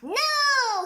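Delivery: high, loud objection. Acted. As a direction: cut them off with a big no. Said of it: "he said".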